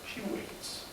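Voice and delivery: whispered. A narrator's reading. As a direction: whisper it, drawing out the suspense. Repeated hushed line.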